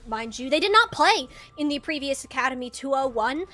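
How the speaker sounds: normal talking voice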